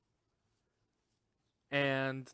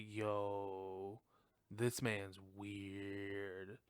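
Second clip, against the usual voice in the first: low, soft, drawn-out suspicion; slow, lowered, mock-uneasy reaction; mock wariness; 5 semitones lower, 7 dB quieter, much slower than usual. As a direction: voice her wary aside, dragging out the words. Voicing her wary reaction to Billy.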